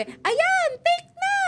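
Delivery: very high, loud mock yell; hammed-up high-pitched film crew cry; urgent crew shout. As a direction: call out to the set in a rush. Crew shouting to roll, retold.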